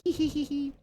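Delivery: high-pitched hee hee giggle; cartoon laugh. Gloats after reloading save.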